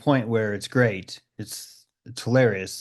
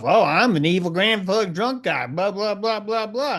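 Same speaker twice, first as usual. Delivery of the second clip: higher, hammed-up grumpy villain voice; mock pissed-off rant; 8 semitones higher than usual. Mock villain pretending to be angry, hammed-up. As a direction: ham up a grumpy evil guy, then trail into blah blah. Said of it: "Then act all pissed off".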